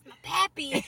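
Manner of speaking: cartoon grandma. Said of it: "When she goes".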